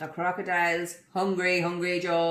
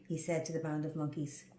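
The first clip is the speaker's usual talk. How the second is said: plain reading voice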